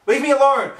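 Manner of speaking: very loud, high shriek; scream. Acted. Twilight screaming at her friend to leave. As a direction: scream it away from you.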